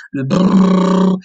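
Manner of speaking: rolling brrr lip trill. Shown as a demonstration. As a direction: buzz the lips like a washing machine. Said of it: "j'appelle ça l'activité, la, la machine à laver".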